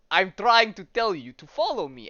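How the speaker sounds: lightly voiced Russian-accented talking dog; insistent line with a touch of Russian accent. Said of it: "it's in a Russian accent".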